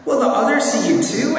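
high-pitched, lightly acted little girl; Lucy's anxious question. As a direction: ask it high, like an unsure child. Reads Lucy's anxious question to Aslan.

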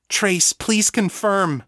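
high, slow, insistent request; pleading for confirmation; urgent plea. A narrator's reading. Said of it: "pushing as hard as she could".

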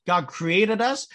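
ordinary talk

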